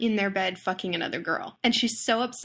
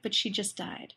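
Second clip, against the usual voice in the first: deadpan; 6 dB quieter than usual. Retold or imitated. Teacher's deadpan reveal.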